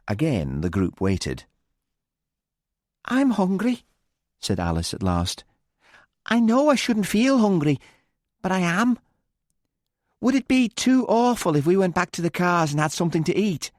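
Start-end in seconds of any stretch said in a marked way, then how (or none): 3.1-3.8 put-on high, tense young woman's voice; high-pitched guilty confession; Alice, pained. A narrator's reading. 6.3-13.7 put-on high, tense young woman's voice; high-pitched guilty confession; Alice, pained. A narrator's reading.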